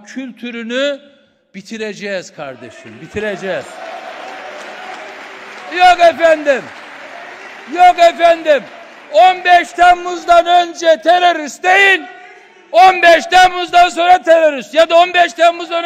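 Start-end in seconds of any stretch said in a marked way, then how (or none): 5.8-15.8 furious political tirade; real furious shouting. Real, not acted. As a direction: yell the charge back across the room. Heated council row, shouting.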